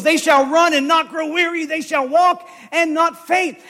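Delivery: loud, driving scripture declamation; impassioned preacher reciting from memory, pushed high and loud; full-force preaching. Acted. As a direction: proclaim the verses with rising fervor.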